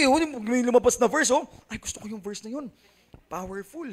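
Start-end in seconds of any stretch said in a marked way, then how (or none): 1.7-3.9 soft, moved aside about the sermon; much softer, as if deeply touched by scripture; imitating devout seatmate. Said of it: "Ganyan siya, oh". (mahinang paghanga, ginagaya ang katabi)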